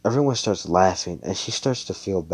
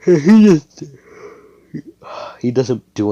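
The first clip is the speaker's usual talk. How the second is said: sudden burst; 9 semitones higher, 12 dB louder than usual. Real, not acted. Excited burst while retelling.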